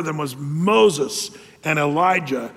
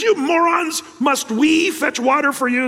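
much higher, louder angry outburst; ticked-off Moses; 8 semitones higher, 3 dB louder than usual. Retold or imitated. Moses scolding the complaining crowd, high angry outburst. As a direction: spit the insult out in contempt. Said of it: "And Moses is ticked off".

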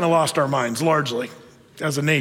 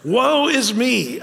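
higher, very slow, hammed-up woe; theatrical mournful cry; prophet's lament; 6 semitones higher, much slower than usual. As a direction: wail it like an Old Testament prophet. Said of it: "does he sound like someone else?".

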